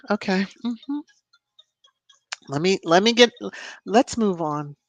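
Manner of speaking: ordinary talk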